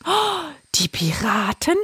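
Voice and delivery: startled, breathless whisper; frightened whisper. A narrator's reading. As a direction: gasp it in alarm. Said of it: "erschrak Mama Pfeilgiftfrosch". (erschrockenes Flüstern)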